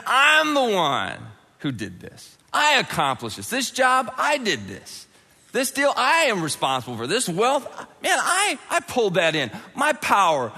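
high, emphatic self-congratulation; boastful. Acted. Preacher acts a man claiming his own wealth, boastful. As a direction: brag hard, punching every claim of credit.

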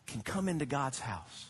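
ordinary talk, nothing marked

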